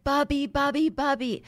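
put-on high, eager child calling out; excited kids calling. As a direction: call like a clingy little kid. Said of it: "And they're just like".

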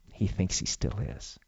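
soft, slow whisper; gossipy whisper. Pretend. Residents mocking the old man who thinks he's still on air, slow whisper. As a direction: hush it behind his back. Gossip imagined in nursing home.

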